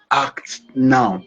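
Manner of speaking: slow, deliberate emphasis; emphasizing. Real, not acted. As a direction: lean on every word, slowly. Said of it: "I'm emphasizing on this".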